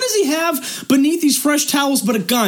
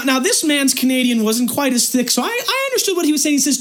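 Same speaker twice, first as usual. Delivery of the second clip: usual speaking voice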